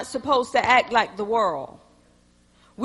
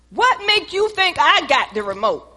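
higher, louder irritated comeback; snappy retort; 5 semitones higher, 4 dB louder than usual. Acted. Wife snapping at her husband over the remote, irritated. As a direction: snap back at him. Wife snaps back, acted quarrel.

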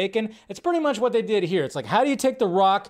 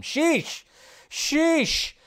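high drawn-out "Sheesh"; mock shocked sheesh; 10 semitones higher than usual. Real, not acted. Vlogger reacting to a harsh fan comment, mock shock. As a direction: exclaim it like a stunned onlooker.

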